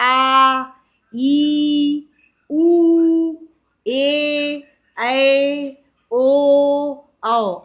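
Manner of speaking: each vowel held long and loud. Shown as a demonstration. Teacher demonstrating long vowels to the class. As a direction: stretch every vowel out loud. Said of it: "जब हमने दीर्घ स्वर बोला".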